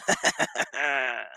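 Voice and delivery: staccato cackle; real laugh. Real, not acted. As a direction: laugh in a quick run, trailing away. Laughs at own joke.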